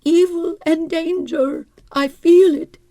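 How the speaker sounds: high, plaintive invalid voice; a nervous hypochondriac wife, complaining; fretful wife. Acted. Mrs Pritchard's fretful voice.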